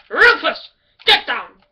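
loud falling yell, ordering; commanding shout. Acted. Ordering the jumping dog down, loud falling shout. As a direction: bark the name down, then the order.

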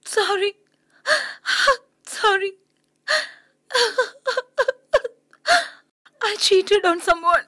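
high, tearful; weepy apology; upset crying. Acted. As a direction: push him away through tears.

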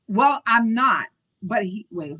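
ordinary delivery, nothing marked

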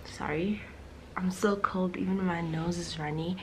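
soft early-morning whisper. Real, not acted. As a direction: keep it hushed. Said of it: "I'm whispering".

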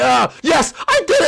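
high, loud victory yell; elated shriek of success; triumphant shout. Acted. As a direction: burst out cheering. Junior celebrates his win.

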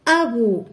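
أبو with a firm, loud glottal onset on the first a; hamza pronounced. Shown as a demonstration. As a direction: start أبو on a clear glottal stop. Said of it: "ما يمكنناش نطقوها بدون همزة".

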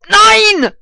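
loud high no; frustrated shout. Real, not acted.

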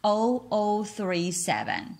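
slow, evenly separated digits. Shown as a demonstration. Teacher showing students how to read a phone number, digit by digit. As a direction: read each number out one at a time, slowly.

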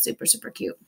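breathy whisper of "super cute", barely voiced. Real, not acted. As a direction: whisper "super cute" softly to yourself, without voice.

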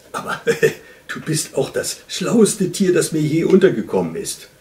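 amused, quickening compliment; chuckling admiration. A narrator's reading. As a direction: laugh and concede it heartily. Lynx chuckles, praising fox.

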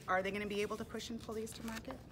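plain reading voice

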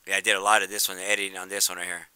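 usual speaking voice

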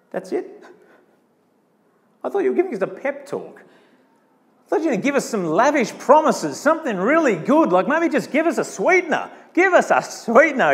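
high, incredulous protest; mock disappointment. Listeners complain the pep talk disappoints.

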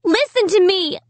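high-pitched mild shriek. A narrator's reading. Child trying to make the dummy listen, shrieking. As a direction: cry out high to be heard. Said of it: "I shrieked".

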